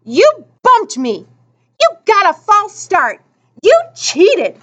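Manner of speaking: high, angry accusation; protesting. A narrator's reading. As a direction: accuse your rival of cheating. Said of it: "his protest drowned in the pandemonium".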